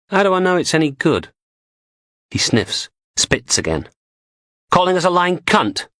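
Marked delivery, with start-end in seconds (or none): 0.1-1.3 higher, quick posh English doubt; a posh lady quizzing the dealer, fast and sceptical; posh English woman. A narrator's reading. Blondie questions the goods. 4.7-5.9 dealer bristling at the posh woman, indignant; offended dealer. A narrator's reading. Sparks takes offence at Blondie.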